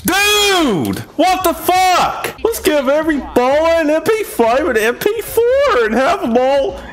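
high, shouted gamer rant; incredulous rage. Real, not acted. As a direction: wail the complaint at the top of your range. Sarcastic rage at the game.

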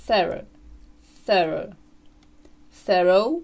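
put-on thorough with the girl vowel in its first syllable; THUR-uh or THUR-oh for thorough, modelled clearly; alternative American pronunciation. Shown as a demonstration. Teacher shows another American variant, careful model pronunciation. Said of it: "ще один варіант американської вимови".

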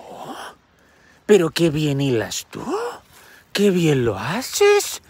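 put-on slow, sugary old woman praising; a lightly voiced old woman flattering the girl; old lady voice. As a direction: coo it like a sly old lady. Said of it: "esta señora vieja ... le dijo".

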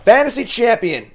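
high, loud proud announce; proud boast. Real, not acted. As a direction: crow about the title. Bragging about fantasy league.

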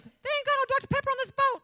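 very high, loud mock yell; outraged complaint. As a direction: imitate a husband's shrieking complaint. Imitates husband complaining on cruise.

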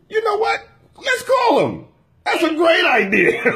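high, rising-energy defiant comeback; mock enthusiasm. Customer answering the threat to call cops, gleefully defiant. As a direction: seize on the threat with exaggerated high-pitched delight.

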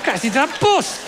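high-pitched blaming rant; mock exasperation. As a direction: blame your passenger for the crash. Acted panic after car crash.

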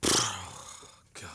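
breathy worn-out sigh of a word; exhausted whisper. Retold or imitated. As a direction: whisper it like you're completely drained. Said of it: "and she goes".

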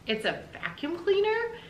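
very high questioning voice; wife taken aback by her present; incredulous wife. Acted. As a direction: question the gift in high-pitched disbelief.

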